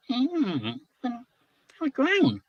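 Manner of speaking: a little creature doubting the plan; weasel's worry. A narrator's reading. Weasel fearing it will drown, hesitant. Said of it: "said the weasel".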